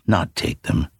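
ordinary delivery

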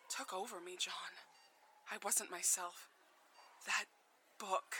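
ordinary delivery

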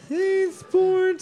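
very high mock excitement; squeaky cheer. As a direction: squeal it like a fan.

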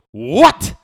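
explosive high shout, mock French accent; loud, sharp, exasperated French outburst; angry French woman. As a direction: imitate an irate Frenchwoman barking a question. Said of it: "In French".

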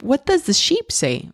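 put-on high sing-song question to a toddler; parentese question. Mom asking her toddler what the sheep says, high sing-song. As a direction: ask it like a game with a little child.

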